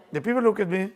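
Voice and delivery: ordinary delivery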